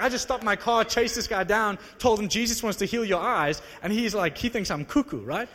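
ordinary talk

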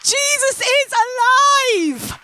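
high, loud joyful praise shouting; ecstatic celebration. Retold or imitated. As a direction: belt it out like she's just been healed. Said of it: "She was going like this".

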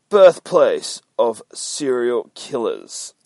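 slow loud ominous read; ominous sign reading. Acted. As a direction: drag out each word like a horror trailer.

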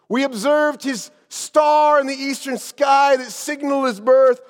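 high, loud proclamation. Acted. Azariah addressing everyone at the city gate, shouting. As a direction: shout it out to the whole crowd in measured phrases. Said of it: "raised his voice with a shout".

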